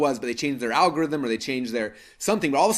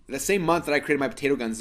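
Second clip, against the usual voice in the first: ordinary talk, nothing marked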